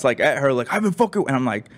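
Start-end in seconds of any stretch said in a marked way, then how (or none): 0.7-1.2 high, mock raging outburst; imitated ranting. Imitating the guy going nuts at her, high mock rant. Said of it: "going nuts".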